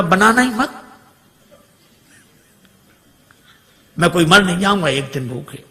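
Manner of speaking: husband's mock quarrel shout; fake angry husband. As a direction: snap back for the guest to hear. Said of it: "जोर जोर से इन्होंने झगड़ा करना शुरू किया".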